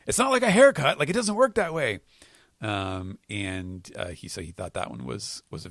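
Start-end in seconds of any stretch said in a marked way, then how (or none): 0.1-2.0 high, loud, mock-exasperated outburst; snapping retort. Bonney snaps at the scientist's haircut excuse, mock-indignant. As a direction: snap back in a much higher voice. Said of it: "she snaps on him".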